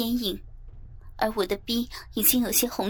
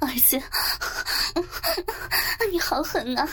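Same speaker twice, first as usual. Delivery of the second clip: higher, strained voice with gasps between phrases; breathless, overwhelmed; 8 semitones higher than usual. Acted. Narrator voicing the mother's outcry, breathless and overwhelmed. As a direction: gasp out short broken phrases.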